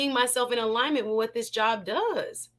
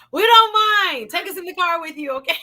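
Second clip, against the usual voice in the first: louder, higher, playful; loud call-out; 7 semitones higher, 7 dB louder, faster than usual. Real, not acted. Host shouting to a viewer leaving, loud and playful. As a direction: call it out after them.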